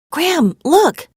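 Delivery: high, loud excited call to a friend. A narrator's reading. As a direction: grab her attention, thrilled. Spotting the turtle move.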